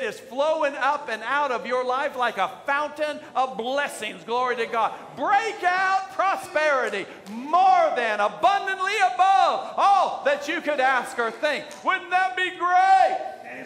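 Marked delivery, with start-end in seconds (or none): 5.2-13.6 shouted, high preaching climax; preaching at full force. Acted. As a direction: lift the whole church with your voice. Preaching builds to a peak.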